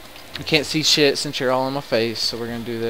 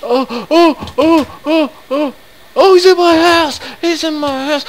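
high, loud shrieks of panic; real panicked screaming; 12 dB louder than usual. Real, not acted. Player finding an Enderman inside his house, panicked shrieking. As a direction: shriek as the monster bursts in.

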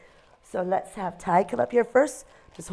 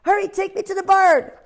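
much higher, louder owl voice crying out; a tiny owl pretending there's an emergency; little owl's alarm; 12 semitones higher, 6 dB louder than usual. Ozzie urging the duck.

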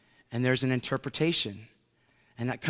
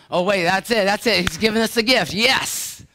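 loud, high, hammed-up fake revelation; mock excited preacher; 8 semitones higher, 9 dB louder than usual. Preacher mocking a fake tongues leader, loudly excited. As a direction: ham up a gullible preacher hearing a gift. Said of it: "that's some preacher being stupid".

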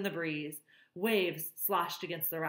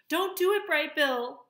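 high, loud cry of alarm; urgent warning; 11 semitones higher, 7 dB louder than usual. A narrator's reading. Flock to their leader, urgent warning. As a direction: call out urgently to stop him.

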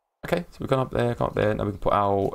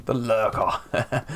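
teasing drawn-out; 4 semitones higher, much slower than usual. Pretend. Teasing a silent viewer.

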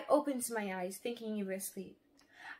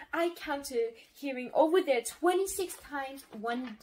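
ordinary delivery

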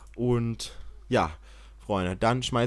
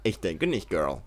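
a lightly sassy put-on voice for Kim; a hint of a sassy diva; voicing Kim; 5 semitones lower, 4 dB quieter than usual. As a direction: dismiss him like a sassy girl. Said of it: "Die Kim so".

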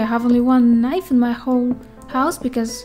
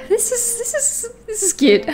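cooing; 10 semitones higher than usual. Real, not acted. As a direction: coo over something cute. Cooing at frog.

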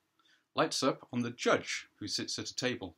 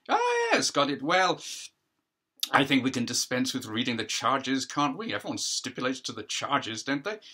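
judge addressing a hearing; 3 semitones higher, 6 dB louder than usual. Acted. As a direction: play the judge holding court, a pitch up and projected.

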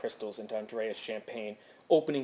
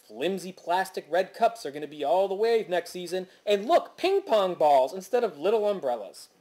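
a hint of gushing shopping-channel host, raised pitch; higher, louder, lightly mocking sales patter; excited trend spotter; 5 semitones higher, 4 dB louder than usual. Narrator mimics shopping-network women spying on zany kids, excited.

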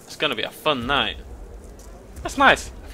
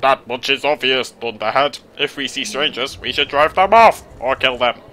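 louder, harsh elder monster voice; gruff Greenblitz voice; 4 dB louder than usual. Acted. Greenblitz scorns the other elders in a harsh voice. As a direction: growl it out like a scornful brute. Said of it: "That really hurt my throat".

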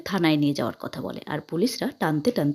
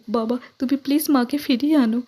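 higher crying request; tearful plea; 5 semitones higher, 3 dB louder than usual. A narrator's reading. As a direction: beg father through tears. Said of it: "রূপা কাঁদতে কাঁদতে বাবাকে request করে বলে".

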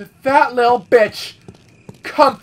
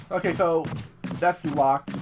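normal talking voice

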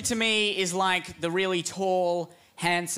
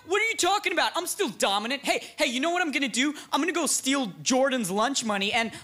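much higher, rushed defensive; higher flustered bragging; flustered denial; 8 semitones higher, faster than usual. Acted. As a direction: scramble to save face.

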